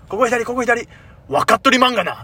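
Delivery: a Kansai mother's insistent directions, imitated; 関西弁の母親の真似; imitating Kansai mother. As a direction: do his mom's Kansai, brisk and pushy. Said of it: "めちゃくちゃ関西弁".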